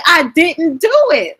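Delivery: high, slow mock plea of innocence; pleading denial. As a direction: insist you're innocent, voice up high. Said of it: "I'm like".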